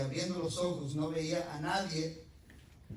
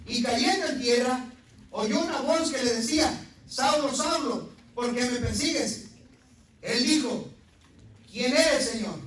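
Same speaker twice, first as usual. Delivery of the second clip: loud, high scripture proclaimed from the pulpit; preached reading; 9 semitones higher, 10 dB louder than usual. Acted. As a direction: call out the verse like a preacher.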